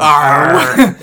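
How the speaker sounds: growled pirate captain Arr; mock pirate growl. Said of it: "pirate captain".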